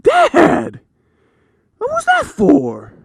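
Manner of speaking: put-on high whiny kid voice; hurt little boy. Son asking dad why he hit him, high whiny kid voice. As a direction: whine like a wronged child. Son after being smacked.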